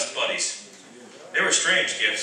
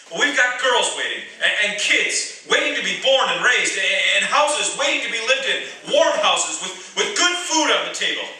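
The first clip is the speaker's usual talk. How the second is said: impassioned plea; 7 semitones higher, 3 dB louder than usual. Acted. Impassioned speech to prisoners.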